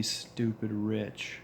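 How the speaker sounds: normal talking voice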